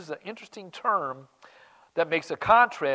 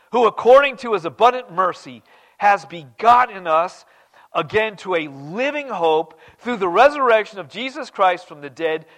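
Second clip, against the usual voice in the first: loud, ringing scripture read; 3 semitones higher, 9 dB louder than usual. Acted. Preacher reading First Peter aloud, loud and proclaiming. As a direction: proclaim the verse to the room.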